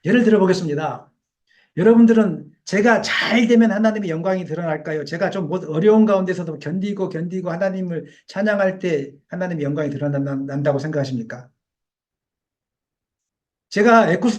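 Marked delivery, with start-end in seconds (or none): none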